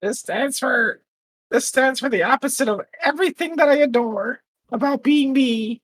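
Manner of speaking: high tiny fairy voice, indignant. Pretend. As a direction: complain like a tiny offended fairy. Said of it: "is that your fairy voice?".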